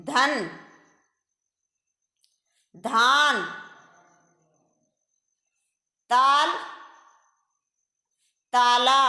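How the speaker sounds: put-on each word said clearly and slowly for children to write; slow dictation. Shown as a demonstration. Teacher dictating to kids, careful clear words. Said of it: "बोल बोल कर लिखेंगे".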